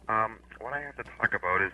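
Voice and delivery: too quiet, barely carrying; speaking softly. Real, not acted. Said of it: "Would you speak up".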